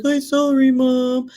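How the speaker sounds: put-on high, drawn-out little kid voice; son's apology. Son saying sorry to mom, high child voice. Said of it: "and he's like".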